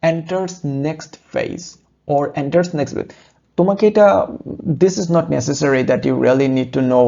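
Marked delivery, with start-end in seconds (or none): 0.1-3.1 "or enters next phase" read very slowly, word by word; slow model reading. Shown as a demonstration. Teacher modelling the correct reading for students, slow and soft. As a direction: spell it out slowly and softly for learners. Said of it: "এটার correct pronunciation হচ্ছে or".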